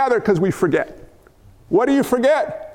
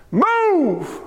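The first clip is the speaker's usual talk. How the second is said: mock grand command, higher with a laugh; commanding a mountain; 6 semitones higher than usual. Shown as a demonstration. Preacher commanding the trees to move, hammed-up shout. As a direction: order the mountain to move, hamming it. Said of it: "I'm gonna take this verse so far out of context".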